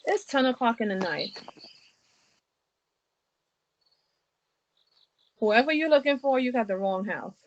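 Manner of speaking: tinny voice through a doorbell speaker. Real, not acted. Homeowner answers through doorbell camera.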